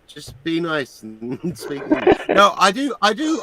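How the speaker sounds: ordinary talk, nothing marked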